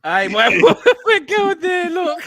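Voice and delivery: laughing. Real, not acted. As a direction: try to talk through helpless laughter. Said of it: "laughing hard".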